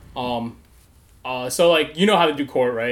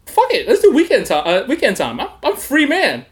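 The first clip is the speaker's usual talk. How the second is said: much higher and louder, carefree; reckless relief; 11 semitones higher, 6 dB louder than usual. Retold or imitated. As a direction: blurt it out like you got off easy. Choosing weekend jail, relieved.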